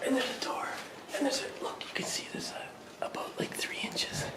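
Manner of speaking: very soft, breath-only whisper. Real, not acted. Showing viewers a neighbour's open door, whispering. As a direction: whisper as if neighbours might overhear. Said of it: "I don't know why I'm whispering".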